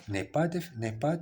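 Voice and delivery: ordinary talk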